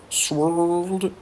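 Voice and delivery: Sword said the English way, in a light English accent; a quick playful English accent on the word Sword. Shown as a demonstration. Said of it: "Comme petit accent anglais".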